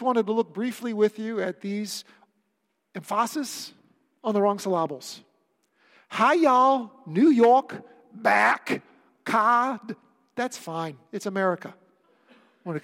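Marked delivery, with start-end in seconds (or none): 2.9-5.2 "emphasis" as em-FAH-sis, "syllables" as sil-LAH-bles; wrong-syllable stress. Shown as a demonstration. Deliberately misplaces stress, a running joke. 6.1-10.0 loud mock Southern, New York and regional accents; hammed-up tour of American accents.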